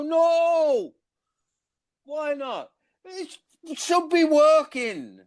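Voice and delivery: put-on stepdad's indignant reply in a high, slow Coventry accent; Coventry stepdad impression. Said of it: "I got good at Coventry".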